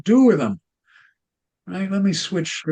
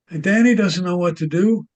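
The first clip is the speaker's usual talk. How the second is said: ordinary delivery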